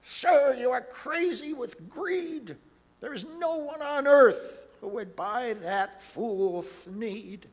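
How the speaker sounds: scolding Lorax. Acted. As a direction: tell him off sharply. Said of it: "The Lorax said".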